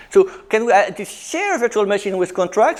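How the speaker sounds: ordinary talk, nothing marked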